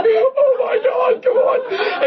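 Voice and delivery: high, loud, hammed-up cry of dread; mock panic. Dreading the nurse's plunger, a high hammed-up cry. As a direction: ham up the terror of the needle.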